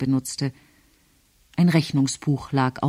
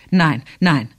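a firm, mildly raised no; cried out; 5 dB louder than usual. A narrator's reading. Julius recoiling from Caroline, a mild raised cry. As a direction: push the no out as a short cry. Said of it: "rief er".

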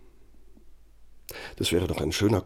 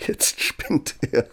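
laughing; slower than usual. Retold or imitated. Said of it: "dachte ich mir".

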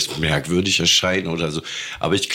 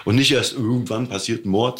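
normal talking voice

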